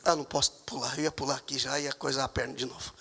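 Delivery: soft muttered aside; low quiet aside. Real, not acted. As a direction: drop low and soft, to himself. Aside about his leg.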